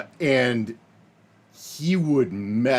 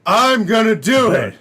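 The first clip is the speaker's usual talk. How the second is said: higher and louder, a mock childish shout; loud funny words; 11 semitones higher, 5 dB louder than usual. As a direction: belt it out like a big silly announcement. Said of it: "I'm gonna say the loud, funny words".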